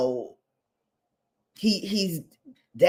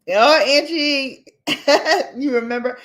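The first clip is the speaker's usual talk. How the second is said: laughing; 7 semitones higher, 4 dB louder than usual. Real, not acted. Laughing call to a friend.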